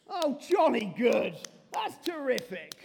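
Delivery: stiff upper lip British reaction; posh British accent. Pretend. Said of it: "God isn't British".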